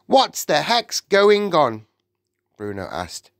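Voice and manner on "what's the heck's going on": put-on high, loud, slow boy's question; indignant boy. A narrator's reading. Boy on the platform questioning the witch, indignant. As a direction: demand an explanation, pitched up.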